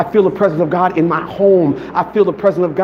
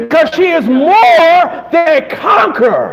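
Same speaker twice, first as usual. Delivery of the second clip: preaching; 10 semitones higher, 4 dB louder, slower than usual. Acted. Preaching prayer at full force.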